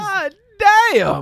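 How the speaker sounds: high, loud shocked exclamation. Real, not acted. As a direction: react like the price stuns you. Shocked at 400 dollar bill.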